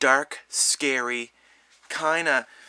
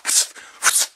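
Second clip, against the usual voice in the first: whispered whoosh effect. Retold or imitated. Killer dodging arrows.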